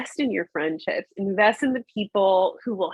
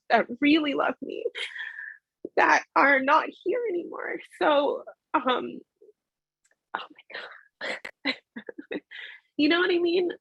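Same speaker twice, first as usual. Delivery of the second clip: tearful; 7 semitones higher than usual. Real, not acted. Crying over lost friend.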